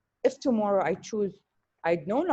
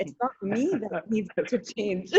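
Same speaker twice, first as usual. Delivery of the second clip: laughing. Real, not acted. Laughs while making her point.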